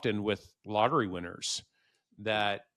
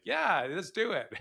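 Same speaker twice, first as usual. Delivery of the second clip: high, eager yes; excited; 9 semitones higher than usual. Retold or imitated. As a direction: cheer it on brightly. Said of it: "It's like".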